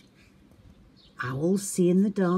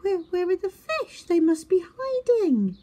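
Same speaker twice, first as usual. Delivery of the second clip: high, puzzled and innocent; confused little owl voice; 4 dB louder than usual. A narrator's reading. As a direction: stammer it in wonder. Twit searching.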